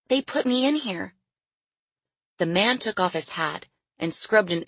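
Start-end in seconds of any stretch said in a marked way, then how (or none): 0.1-1.1 put-on high, small child's voice; timid little girl. A narrator's reading. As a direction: find your voice, small and high. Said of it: "She swallowed and found her voice".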